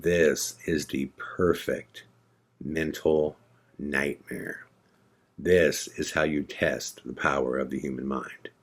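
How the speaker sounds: slow, hushed, measured words with pauses; grave realization. Retold or imitated. Narrator taking in the freaked-out room, slow and grave. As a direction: let it sink in, low and soft. Retold grave thought amid panic.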